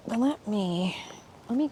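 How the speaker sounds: breathy whispered aside; whispering. Real, not acted. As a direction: whisper it to yourself. Aside while setting up.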